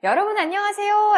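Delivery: high, loud stage hello; crowd greeting. Acted. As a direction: hail a whole audience.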